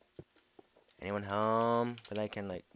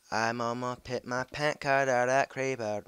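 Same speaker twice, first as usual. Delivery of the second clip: ordinary delivery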